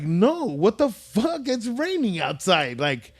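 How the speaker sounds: imitating incredulous outrage; indignant retort. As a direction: drag it out like an offended customer. Told to sit outside in rain.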